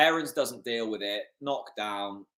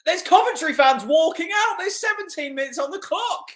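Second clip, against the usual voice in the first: gleeful taunt; 5 dB louder than usual. Real, not acted. Mocking fans leaving early.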